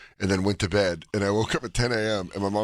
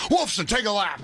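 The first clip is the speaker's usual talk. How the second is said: higher, barking coach calling players over; coach calling names; 10 semitones higher than usual. Pretend. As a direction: call out names like a dazed coach.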